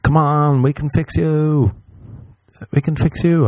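high, slow, gushing "we can fix you"; mock savior voice. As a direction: play the eager fixer, sugary and sure. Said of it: "you're like".

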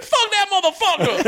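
squeaky high mock cop-siren voice; mock high-voiced translator. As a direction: pitch way up, hammed-up translator. Imitating the translator.

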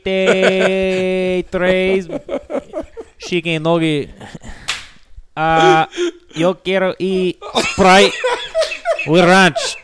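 high, loud, slow mock Spanish; laughing through a hammy Spanish order; fake Spanish accent. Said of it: "That's how I was talking in the drive-thru".

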